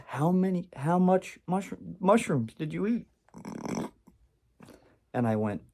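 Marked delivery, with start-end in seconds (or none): 0.1-3.1 high-pitched, stumbling incredulous question; imitated amused disbelief. Laughing brother quizzes him, imitated amused disbelief. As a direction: ask it high, as if cracking up. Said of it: "And he's laughing, and he goes".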